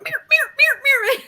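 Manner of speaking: high, loud string of playful meows; excited meows. Retold or imitated. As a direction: meow over and over like a giddy cat. Dog pouncing on toy.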